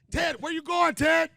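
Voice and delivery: high, loud call across the crowd. Real, not acted. To Ted leaving the stage, a loud high call. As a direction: call Ted back as he walks off.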